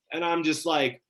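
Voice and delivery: ordinary delivery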